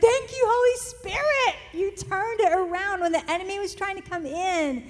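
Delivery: put-on mother thanking the Holy Spirit after her kids' answer, elated and loud; joyful thanks. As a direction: lift it up to heaven in delight.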